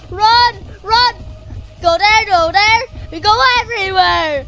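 high, very loud panicked screaming. Real, not acted. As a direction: scream breathlessly through a frantic chase. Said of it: "I'm sorry if I'm screaming too loud".

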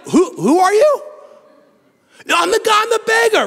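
puzzled townsfolk then eager beggar. Retold or imitated. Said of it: "And they're like".

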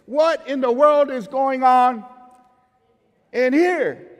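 a hammed-up frazzled dad; exasperated parent. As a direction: burst in on the kids' chaos. Parent walks in on mess.

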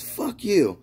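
high-pitched mock defiance; high, curt-tempered comeback; retold refusal. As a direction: imitate a thief brushing off the kids. Thief refuses in acted quarrel.

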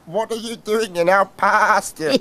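lightly voiced lambs; a hint of bleating. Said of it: "bleated some lambs".

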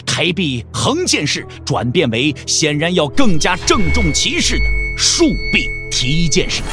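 usual speaking voice

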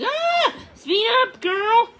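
very high, loud alarmed cry; panicked shriek. A narrator's reading. Puffin yelling at Odette as the arrow flies, panicked. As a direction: yelp then urge her on.

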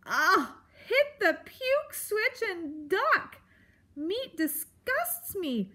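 high, slow, drawn-out revulsion; disgusted outburst. A narrator's reading. Porcupine appalled at eating meat, disgusted. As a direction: stretch each word in theatrical disgust.

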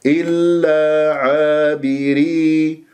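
slow, measured tajweed recitation; Quran recitation demo. Shown as a demonstration. Teacher models a Quran verse for students, slow recitation. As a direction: stretch the long vowels evenly. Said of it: "إذن إلا بس".

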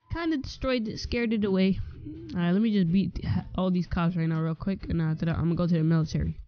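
ordinary talk

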